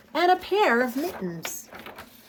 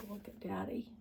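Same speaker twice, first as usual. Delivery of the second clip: whisper; 2 semitones lower, 16 dB quieter than usual. Real, not acted. Reading to kids, whispered aside about Daddy. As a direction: lean in and whisper.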